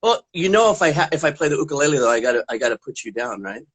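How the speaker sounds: usual speaking voice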